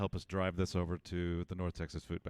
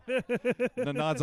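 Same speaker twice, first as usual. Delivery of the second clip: laughing; 14 semitones higher, 5 dB louder than usual. Real, not acted. Laughing while announcing winner.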